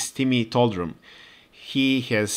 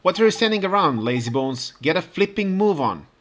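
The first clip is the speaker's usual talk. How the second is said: higher, mock-gruff cockney telling-off; cockney scolding; 6 semitones higher than usual. Cockney local nagging lazy Timmy to move, mock-gruff. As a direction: hurry the lazy cat along, cheeky and impatient.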